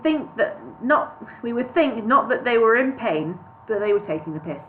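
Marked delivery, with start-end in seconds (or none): none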